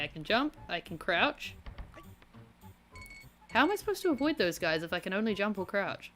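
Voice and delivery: ordinary talk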